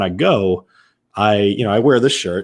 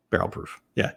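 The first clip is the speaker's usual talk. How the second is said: whispered barrel proof; 7 dB quieter than usual. Shown as a demonstration.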